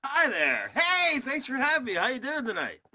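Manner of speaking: put-on high host voice; fake voice. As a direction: greet in a high, obviously fake voice. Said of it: "doing the fake voice".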